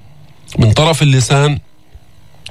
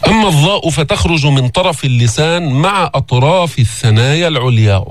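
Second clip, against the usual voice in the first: ordinary delivery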